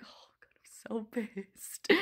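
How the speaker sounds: furious whisper through the teeth; whispered fury. Retold or imitated. Still alone in the empty lab, a furious whisper. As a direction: hiss it to yourself. Fuming under her breath.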